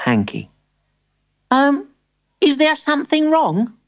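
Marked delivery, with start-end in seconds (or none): 1.5-3.7 put-on high, hesitant child's voice; uneasy storybook boy, high-pitched; worried young grandson. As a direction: ask timidly as a small boy. Timbertwig worried by the stare.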